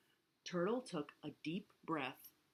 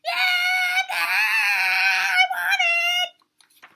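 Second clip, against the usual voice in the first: full-out piercingly high scream; 17 dB louder, slower than usual. A narrator's reading. Turtle throws back her head, screaming for the bracelet. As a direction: scream at the top of your lungs. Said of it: "screamed at the top of her lungs".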